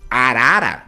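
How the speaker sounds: "Arara" said loud, the repeated syllable drawn out; repeated syllable stressed. Shown as a demonstration. Teacher showing Tupi word-building, "Arara" with emphatic repeated syllable. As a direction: punch out "Arara" so the doubled syllable is clear. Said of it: "veja a repetição do".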